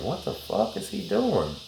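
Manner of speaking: slow, high, stunned wondering; baffled inner thought. Retold or imitated. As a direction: stare at him like he's lost it. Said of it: "I'm like".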